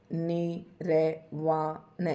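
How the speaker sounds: निर्वाण drawn out very slowly, syllable by syllable; dictating निर्वाण slowly; slow spelled-out word. Shown as a demonstration. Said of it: "इस तरीके से आप लिख कर".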